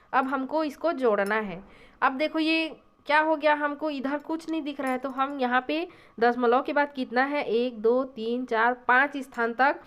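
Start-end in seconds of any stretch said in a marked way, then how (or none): none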